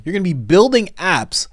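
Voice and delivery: normal talking voice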